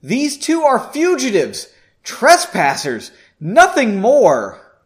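high, loud, slow dismissal; haughty mage. Acted. Lead mage dismisses the fugitives, high, loud and slow. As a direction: look down on them from a height.

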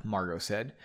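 ordinary delivery, nothing marked

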